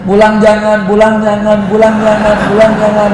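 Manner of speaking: high, loud fretting repeat; anxious child. Retold or imitated. Frightened boy dreading going home, loud and high. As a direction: fret out loud whether to go home.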